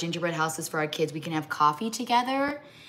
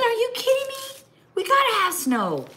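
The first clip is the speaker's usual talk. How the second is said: high, louder indignant protest; mock outrage; 4 dB louder than usual. To her husband about snow, high playful outrage. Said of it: "I said".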